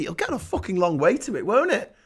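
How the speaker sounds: usual speaking voice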